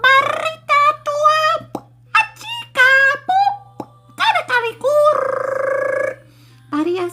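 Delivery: high playful babble like a silly song; high gibberish. Acted. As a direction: squeak out fake Spanish-sounding babble.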